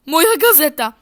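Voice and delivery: loud, high yelp; mock shout for the paper; outcry. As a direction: cry out in dismay. Cries out for newspaper.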